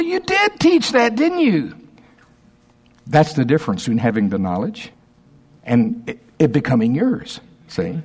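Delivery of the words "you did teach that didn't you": high mock sheepish admission. Imitating his own sheepish concession.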